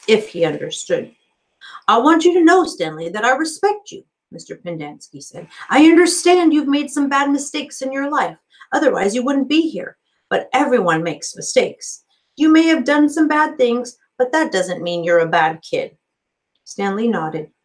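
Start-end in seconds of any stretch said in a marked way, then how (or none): 1.9-4.0 lightly raised friendly counselor voice; a hint of an eager, upbeat counselor; cheery counselor. A narrator's reading. Counselor greeting the new boy, lightly upbeat. Pendanski earnest. 5.7-16.0 lightly raised friendly counselor voice; a hint of an eager, upbeat counselor; cheery counselor. A narrator's reading. Counselor greeting the new boy, lightly upbeat. Pendanski lecturing.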